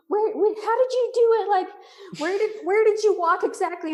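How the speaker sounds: high, loud, retold panic; mock-frantic demand for directions; frantic questioning. Hiker asking friend where exactly to cross, retold frantic questioning.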